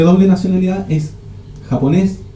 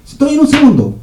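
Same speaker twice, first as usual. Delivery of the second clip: higher, breezy promise of just a second; breezy excuse; 9 semitones higher, 3 dB louder than usual. Retold or imitated. Late friend answering the caller, breezy and higher. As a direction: toss it off like you're nearly there.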